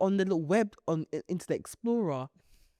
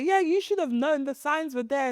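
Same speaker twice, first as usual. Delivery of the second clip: preachy; 9 semitones higher, 3 dB louder than usual. Retold or imitated. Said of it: "we're all quick to say".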